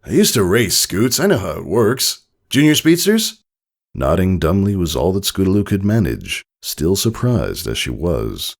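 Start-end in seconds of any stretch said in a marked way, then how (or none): 0.0-3.4 fatherly voice. A narrator's reading. Father Tycho speaks.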